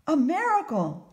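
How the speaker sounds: high, loud cry of amazement; astonished outburst. A narrator's reading. Merchant's wife finds a young man, astonished outburst. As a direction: burst out as if witnessing a wonder. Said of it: "she burst out".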